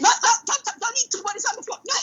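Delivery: high, laughing no no to the dog; laughing scold. Real, not acted. Stopping the dog eating off the floor, laughing.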